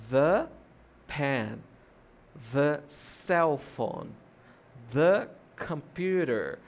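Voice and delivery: each THE punched as "thuh"; slow examples, THE loud with an uh vowel. Shown as a demonstration. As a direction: stress THE as "thuh", pause before or after each noun. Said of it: "a pronúncia é diferente do artigo".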